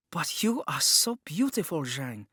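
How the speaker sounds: put-on high, eager girlish exclamation; exclaiming. A narrator's reading. Jun exclaiming over Zhang's beauty, eager. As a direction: burst out in earnest admiration. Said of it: "Jun had exclaimed".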